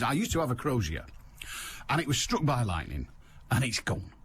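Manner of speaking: ordinary talk, nothing marked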